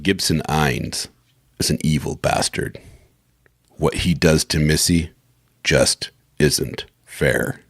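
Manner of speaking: low, slow, heavily paused narration; announcer synopsis read. Acted. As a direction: draw out the last phrase, word by word. Retake of synopsis with added word.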